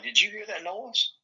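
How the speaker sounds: high-pitched, startled retold question; mock-alarmed, pitch jumping up. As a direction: act out your own alarmed asking. Retelling his startled question.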